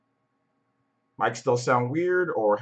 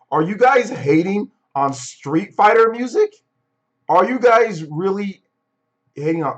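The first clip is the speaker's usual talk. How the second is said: incredulous; 7 semitones higher, 8 dB louder than usual. Real, not acted. Outraged at viewers' opinion.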